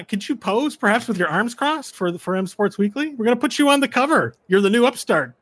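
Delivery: high, loud fawning reporter voice; eager halfling reporter. Acted. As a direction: squeeze through and pitch politely.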